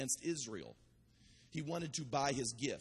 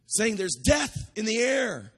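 full-force preaching; 5 semitones higher, 8 dB louder than usual. Acted. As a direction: preach it out hard to the crowd. Fiery sermon at full force.

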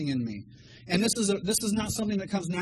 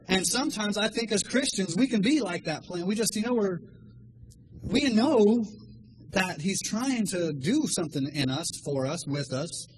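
normal talking voice

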